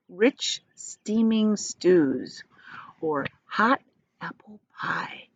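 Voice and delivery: very slow, lingering, hushed craving; savouring. A narrator's reading. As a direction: linger over each dish. Savouring food words.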